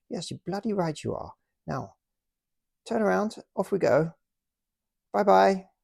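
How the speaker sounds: high, jolly British officer taking a prisoner; British toy officer politely arresting the German, in a high jolly voice. Pretend. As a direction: play the smug officer with the gun, pitched high.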